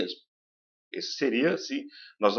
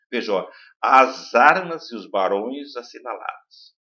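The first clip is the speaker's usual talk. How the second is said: slow, punching each stressed vowel; 3 dB louder, slower than usual. Shown as a demonstration. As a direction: read the verse slowly, leaning on the open A. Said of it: "vamos olhar primeiro as vogais tônicas".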